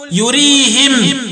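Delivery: "يريهم" as yurihim, drawn out; i after the h of "يريهم", stop on m; kasr ha, still mim. Shown as a demonstration. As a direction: end "يريهم" slowly with -him. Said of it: "وقرأ بإسكان الميم وكسر الهاء وقفا".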